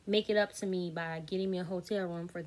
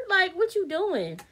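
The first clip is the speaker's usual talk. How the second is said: mock high voice; 10 semitones higher, 5 dB louder, slower than usual. Mocking voice opening clip.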